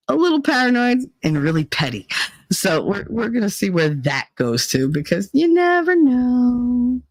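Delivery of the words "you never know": drawn-out teasing. Shown as a demonstration. Joking about her violent mood.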